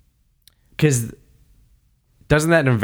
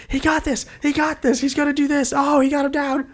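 high, excited fan cheer; excited yelling. Retold or imitated. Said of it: "I was screaming and yelling".

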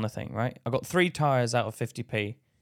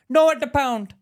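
high, loud mock contradiction; insistent; 10 semitones higher, 6 dB louder than usual. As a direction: imitate a shopkeeper who won't budge. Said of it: "You were so insistent".

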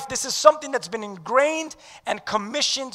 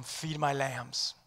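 tender; 8 semitones lower, 15 dB quieter, slower than usual. Acted. Jesus quoted tenderly.

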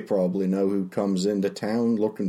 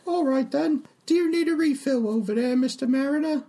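higher chatty tavern barmaid. Acted. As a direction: play the friendly, simple barmaid. Barmaid voice again.

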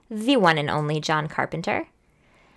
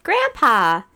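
yelled; 3 semitones higher, 8 dB louder than usual. Retold or imitated. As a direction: shout it out with recognition. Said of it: "I honestly yelled".

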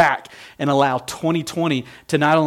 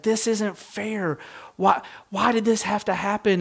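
mock sobbing complaint, pitched up; imitating daughter sobbing; 7 semitones higher than usual. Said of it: "just sobbing".

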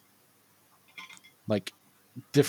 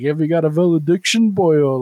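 lightly imitated odd Irish-tinged accent, louder; Dudley Smith; 2 semitones higher, 6 dB louder than usual. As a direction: quote the villain with a hint of odd Irish accent. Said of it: "he's got a weird accent too".

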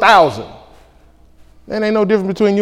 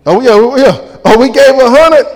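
higher, louder hurried correction; mock sheepish giver; 7 semitones higher, 6 dB louder than usual. Preacher mimics givers changing their story, higher. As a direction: backpedal like you got caught.

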